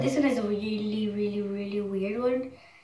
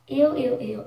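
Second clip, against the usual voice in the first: disgusted; 3 semitones higher, 7 dB louder than usual. Real, not acted. Touching slimy jelly, disgusted.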